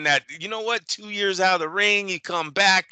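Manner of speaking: ordinary delivery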